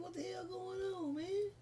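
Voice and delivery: high, soft, frightened disbelief; hushed panic. Retold or imitated. Surrounded by police, high hushed panic. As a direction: let it squeak out under your breath. Frightened confusion during stop.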